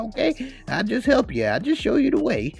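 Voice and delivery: lightly voiced older man reassuring; voiced Lil Rel. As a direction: insist you're only showing the way. Lil Rel as tour guide.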